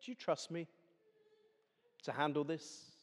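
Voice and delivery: soft, calm reassurance; gentle and calm. Acted. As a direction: speak as God soothing a frightened child. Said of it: "gently and calmly say".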